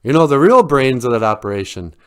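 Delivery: loud dismissive putdown; cocky know-it-all storybook voice; scoffing fox. A narrator's reading. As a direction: knock the rival down with relish.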